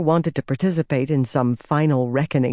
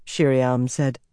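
ordinary delivery

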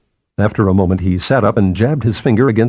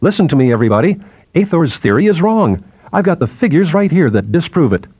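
imagined public proclamation, pitched higher; raised, declaiming narrator voice; calling out; 6 semitones higher than usual. A narrator's reading. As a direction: call it out to everyone below, higher but not shouting. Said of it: "calling out".

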